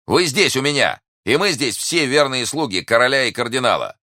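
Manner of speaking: loud, high declaration; calling out. A narrator's reading. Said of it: "крикнул им Д'Артаньян". (громко, приподнятым голосом)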